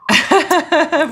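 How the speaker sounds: sudden high burst of laughter; laughing at the trucking joke. Real, not acted.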